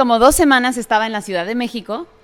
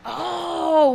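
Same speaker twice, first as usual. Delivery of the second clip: ordinary talk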